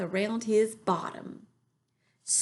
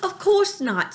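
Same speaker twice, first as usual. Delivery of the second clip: put-on much higher young girl voice; 12 semitones higher, 6 dB louder than usual. A narrator's reading. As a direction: reassure him as a small girl. Sophie figure in story.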